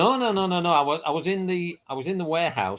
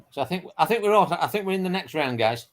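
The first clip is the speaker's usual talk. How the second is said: ordinary delivery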